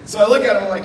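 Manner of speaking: normal talking voice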